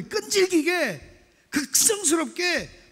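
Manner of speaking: 끈질기게 and 극성스럽게 stretched out slowly and high; slow emphasis. Shown as a demonstration. Pastor stressing persistence to congregation, slow emphatic words. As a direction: lean hard on 끈질기게, 극성스럽게. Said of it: "slow emphatic words".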